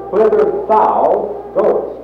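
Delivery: "thou" leaned on, the whole phrase "Whither thou goest" slow and lifted; stress on thou. Shown as a demonstration. Preacher pressing the word "thou" for the congregation, slow and stressed. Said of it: "I want to emphasize the word thou".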